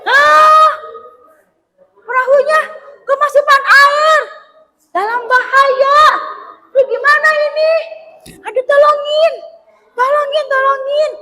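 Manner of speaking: hammed-up high panic, pleading tolongin; frantic for help. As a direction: beg for rescue as water pours in. Disciples panic, boat floods.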